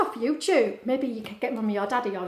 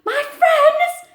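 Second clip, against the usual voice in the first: surprised; 12 dB louder than usual. Acted. Stanley hears news of friends.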